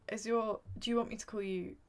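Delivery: ordinary delivery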